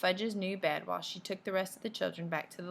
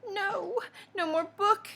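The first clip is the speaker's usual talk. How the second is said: put-on high-pitched, indignant child's yell; high, louder protest from a young child; yelling little boy; 12 semitones higher, 5 dB louder, slower than usual. A narrator's reading. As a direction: yell it like a defiant small kid, pitched way up. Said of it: "Fudge is yelling".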